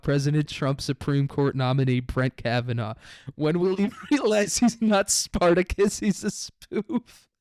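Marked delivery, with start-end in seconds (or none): 3.4-7.3 high, slow, giggling disbelief; laughing while reading. Real, not acted. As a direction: read it cracking up with laughter. Reading mocking statement, laughing.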